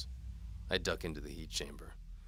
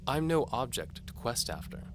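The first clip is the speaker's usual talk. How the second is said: higher, louder teasing lady voice; seductive noblewoman; 7 semitones higher, 7 dB louder than usual. A narrator's reading. Antimony dares the knight to follow. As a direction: tease the knight like a bold noblewoman.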